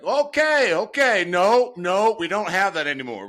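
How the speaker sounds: high, loud alarmed pleading; dad clutching the dash, high and loud in alarm; panicky old dad. Retold or imitated. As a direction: beg them to slow down, high and loud. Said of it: "He's like".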